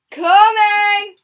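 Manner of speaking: loud shout of coming; calling out. Real, not acted. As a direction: yell to someone in another room. Calling out to someone offscreen.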